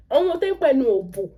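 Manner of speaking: usual speaking voice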